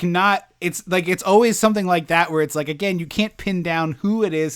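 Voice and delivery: ordinary delivery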